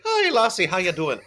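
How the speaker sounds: high-pitched mock Irish greeting; same brogue an octave up, as an Irishman; Irish accent. Shown as a demonstration. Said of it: "an Irishman's like".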